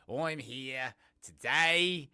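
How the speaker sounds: hammed-up, slow and loud repeat; playfully drawn out. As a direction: stretch "here" and "today" out long.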